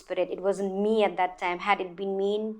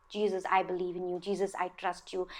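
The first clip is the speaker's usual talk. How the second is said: soft, retold words of trust; quiet prayer; 3 semitones lower, 10 dB quieter than usual. Woman praying to Jesus while fleeing, quiet trusting prayer. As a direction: pray softly, well under normal volume. Retells prayer during the escape.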